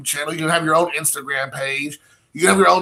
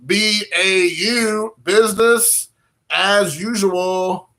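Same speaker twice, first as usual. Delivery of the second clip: stretched slow and sung up high, savoring B A U and business as usual; slow, lilting business as usual; drawn out; 4 semitones higher, much slower than usual. Shown as a demonstration. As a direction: drag out B A U like a slogan. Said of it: "B A U, baby".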